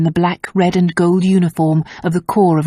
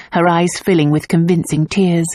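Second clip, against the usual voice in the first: plain reading voice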